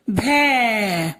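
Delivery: भै held long, gliding down; drawn-out syllable. Shown as a demonstration. Teacher showing भ with its matra, drawn-out भै. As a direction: stretch the vowel of भै. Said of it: "तो हम बोलेंगे".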